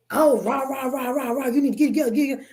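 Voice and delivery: fussy blah-blah put-on voice; mock nagging voice. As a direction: imitate empty nagging, syllables running together. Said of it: "but it's not".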